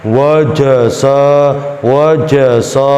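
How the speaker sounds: WAJASA rounded toward "wo-jo-so"; wrongly thick. Shown as a demonstration. Teacher showing what is not allowed, thick wajasa. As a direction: thicken the vowels of wajasa, slowly. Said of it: "Tidak boleh dibaca".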